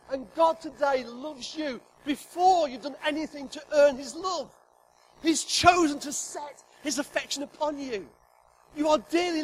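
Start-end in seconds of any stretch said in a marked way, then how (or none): none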